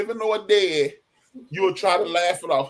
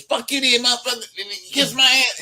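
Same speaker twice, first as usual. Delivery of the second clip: put-on kid's cursing; 8 semitones higher than usual. Childhood argument, cursing out.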